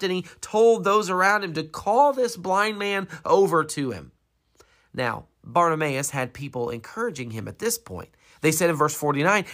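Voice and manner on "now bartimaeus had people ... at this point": ordinary talk, nothing marked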